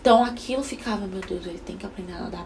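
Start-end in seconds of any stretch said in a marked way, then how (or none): 1.1-2.4 much softer worried inner voice; a hint of anxious inner voice, very soft; anxious murmur. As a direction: mutter it under pressure.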